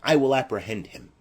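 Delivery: ordinary talk, nothing marked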